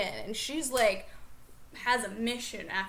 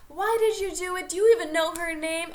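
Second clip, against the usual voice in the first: put-on higher, louder angry yell at the boyfriend; retold yelling; 5 semitones higher, 7 dB louder than usual. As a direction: shout it like a furious girlfriend. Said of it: "she is yelling at him like".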